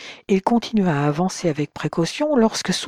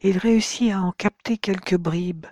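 ordinary delivery

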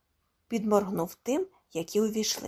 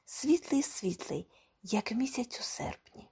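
slow, soft, fading to a whisper; hushed wonder; 3 semitones higher, 6 dB quieter than usual. A narrator's reading. Gomez describes the wonderful summer suit, in hushed wonder. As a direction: savour it in a slow hush.

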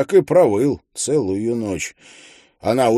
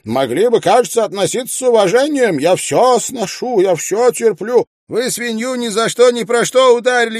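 shouting widow; 9 semitones higher than usual. A narrator's reading. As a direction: hurl every grievance at him. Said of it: "кричит".

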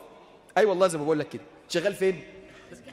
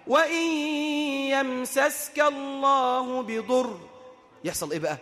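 slow, drawn-out chanted recitation, pitched high; solemn tajweed chant; Quranic recitation; 5 semitones higher, much slower than usual. Acted. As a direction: recite the verse with reverent, stretched vowels. Quran verse recited in sermon.

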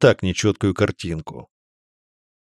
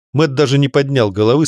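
ordinary delivery

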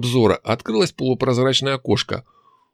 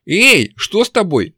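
high and loud, slowed, alarmed; alarmed exclamation; 9 semitones higher, 5 dB louder, slower than usual. A narrator's reading. Nastya alarmed as her companion freezes, loud. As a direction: call out in alarm. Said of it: "тревожно воскликнула Настя".

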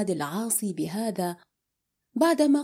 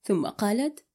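ordinary delivery, nothing marked

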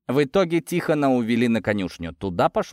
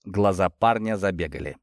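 ordinary delivery, nothing marked

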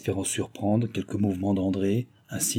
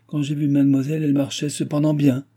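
higher, louder mocking retort; sneering; 6 semitones higher, 5 dB louder than usual. A narrator's reading. Maid doubting her mistress's account, mildly sneering. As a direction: contradict her with a slight sneer. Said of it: "en ricanant".

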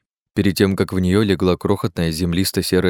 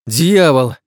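higher, louder cry of alarm; exclaimed curse; 7 semitones higher, 6 dB louder than usual. A narrator's reading. Companion frets beside the fading wounded man, exclaimed curse. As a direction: curse out loud in agitation.

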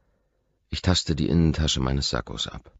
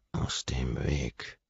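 menacing whisper; 6 dB quieter, much slower than usual. A narrator's reading. Forcing past the roommate.

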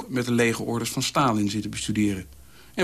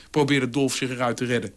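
usual speaking voice

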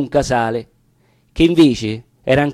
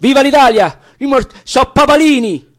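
much higher, loud rallying shout; battle cry; 10 semitones higher, 5 dB louder than usual. Acted. Fighters shouting at the advancing papal troops, high battle cry. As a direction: hurl the cry at the enemy.

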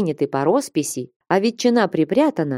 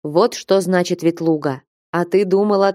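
ordinary delivery, nothing marked

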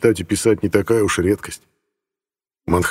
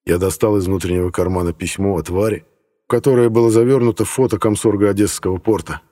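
plain reading voice

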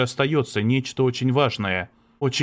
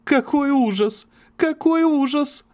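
slow, much higher, sobbing repeats; hysterical sobbing; 4 dB louder than usual. Acted. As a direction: break down sobbing the words. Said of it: "истерически зарыдала, повторяя со всхлипами". (истерически рыдая)

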